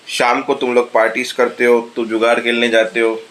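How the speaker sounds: ordinary talk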